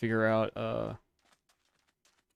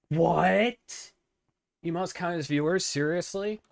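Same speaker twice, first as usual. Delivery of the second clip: surprised; 8 semitones higher, 3 dB louder than usual. Real, not acted. Learns emotes count as viewers.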